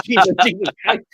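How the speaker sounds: high, laughing mock astonishment; amused shocked exclamation; retold disbelief. Retold shock at corporal's offer.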